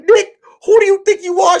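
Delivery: very high, loud mock yell; shrieked challenge, imitating her; imitated angry yelling. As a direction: act out a furious girlfriend yelling. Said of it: "She's yelling at me".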